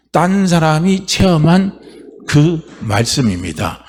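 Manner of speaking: slow, deliberate emphasis; slow emphatic. Real, not acted. Preacher stressing the second weapon, slow and deliberate. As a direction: spell it out slowly for the hall.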